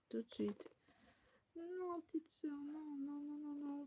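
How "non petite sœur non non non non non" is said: soft, high pleading nos; big sisters softly pleading with little sister. Acted. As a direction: beg her gently to stay.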